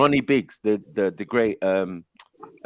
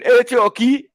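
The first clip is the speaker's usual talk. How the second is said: high, loud mock accent on hey Chalky; put-on accent; 9 semitones higher, 5 dB louder, slower than usual. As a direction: do the comedian's fake accent. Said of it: "what's all this accent".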